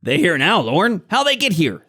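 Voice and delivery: high-pitched goblin challenge; accusing goblin. Acted. Goblin questioning Lorne about the intruders, indignant.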